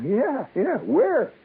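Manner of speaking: high, eager yelping yes. Retold or imitated. Young man eagerly accepts the job on the phone, high and eager. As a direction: jump at the offer.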